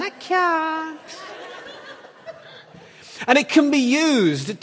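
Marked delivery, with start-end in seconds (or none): 0.0-1.0 high, small puppet voice, softer; Orville. Acted. Puppet bird rejecting encouragement, high soft plaintive voice. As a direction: squeak a feeble, drawn-out refusal. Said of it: "Orville syndrome".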